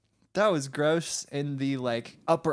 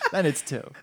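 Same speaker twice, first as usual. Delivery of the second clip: ordinary talk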